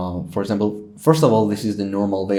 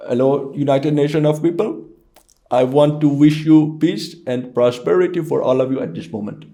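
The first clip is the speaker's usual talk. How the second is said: raised, put-on Indian accent; Indian or Pakistani accent; 5 semitones higher than usual. Shown as a demonstration. Guest greets the United Nations, mock Indian accent. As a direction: make a speech in an Indian accent. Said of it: "also the Indian or Pakistani accent".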